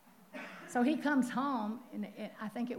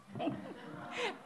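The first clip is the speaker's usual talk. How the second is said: high breathy laugh; 12 semitones higher, 6 dB quieter than usual. Real, not acted. Preacher laughing at the story.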